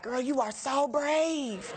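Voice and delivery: slow, drawn-out mock admiration; an onlooker at the bar, slow and sarcastic. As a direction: stretch it out, dripping with irony.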